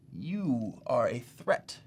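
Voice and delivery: slow, grave accusation; slow right down and let the accusation land; dramatic. Acted. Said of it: "I don't mean to sound so dramatic".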